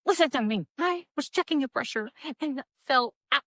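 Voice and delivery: high, urgent pleading, rising to loud; retold panic, insist on being believed; frantic explaining. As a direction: build to a high, loud mock-frantic protest. Retold frantic plea to the doctor.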